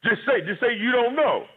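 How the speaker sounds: high, pushy mock plea; pressing him to just say it; frantic pleading dude. Retelling the guest pushing him, high frantic plea. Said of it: "This motherfucker got out of his body".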